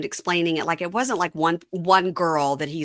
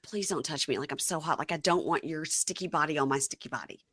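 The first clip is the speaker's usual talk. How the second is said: much softer retold mumble; subdued plea; 10 dB quieter than usual. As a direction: play a weary, overheated husband begging off. Imagining Josh's low plea.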